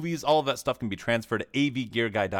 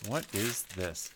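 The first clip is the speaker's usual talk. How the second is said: usual speaking voice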